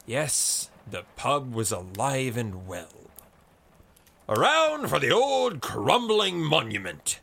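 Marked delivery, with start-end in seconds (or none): 4.3-7.2 loud, high rallying cry; bursting out. A narrator's reading. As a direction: burst out to the whole pub. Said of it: "burst out Blazefeather to everyone in particular".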